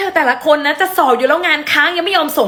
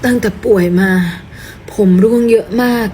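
lower, subdued and sorrowful; sad sick person; 5 semitones lower than usual. Acted. A sick woman sadly explaining her shaved head.

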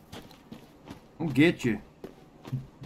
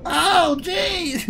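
surprised yelp; 6 dB louder than usual. Real, not acted. Laughing at clown video.

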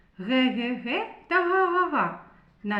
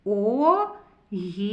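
slow reading; 3 semitones lower, slower than usual. Shown as a demonstration. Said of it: "Прочитаємо".

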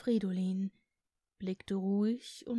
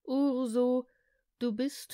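calling out; 3 semitones higher, 5 dB louder than usual. A narrator's reading.

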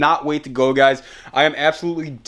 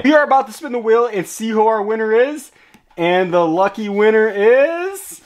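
hyped giveaway announcement; 5 semitones higher than usual. Real, not acted. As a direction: build suspense before the reveal. Live giveaway winner reveal.

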